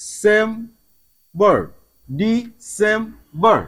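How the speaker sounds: "December" in three slow, separate beats; very slow, syllable-by-syllable "December"; split into syllables. Shown as a demonstration. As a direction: pause between each part: "ডি-সেম-বর". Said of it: "তিন ভাগে ভাগ করা যাবে".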